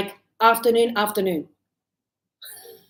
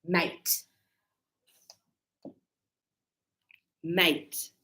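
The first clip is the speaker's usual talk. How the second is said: Australian mate; 5 semitones lower, 7 dB quieter than usual. Shown as a demonstration.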